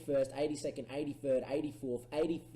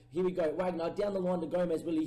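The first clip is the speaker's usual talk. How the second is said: normal talking voice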